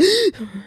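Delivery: short sharp gasp for air; breathless gasp. Retold or imitated. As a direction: gasp like you just ran. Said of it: "I walk two minutes fast and I'm like".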